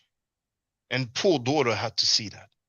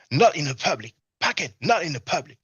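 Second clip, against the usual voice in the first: quoting a gang leader; 4 semitones higher than usual. Retold or imitated. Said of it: "the leader of the B G A tells him".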